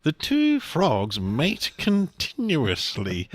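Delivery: slow hushed nature-documentary narration; lightly voiced wildlife presenter; David Attenborough. A documentary narrator describing mating frogs, slow. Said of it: "David Attenborough is like".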